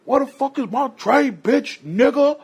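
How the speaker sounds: high mock yell from an angry inmate. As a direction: shout it out like a furious hungry prisoner.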